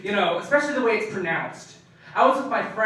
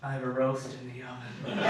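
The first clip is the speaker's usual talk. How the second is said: low, soft anticlimax; sudden small-voiced confession; deflated excuse; 7 semitones lower, 12 dB quieter than usual. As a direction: drop to a quiet, sheepish admission. Deflated excuse ends the storming out.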